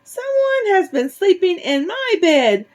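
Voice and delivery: very high, tiny squeak; high-pitched baby bear voice. A narrator's reading. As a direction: squeak it like a little bear cub. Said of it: "squeaked in his tiny little voice".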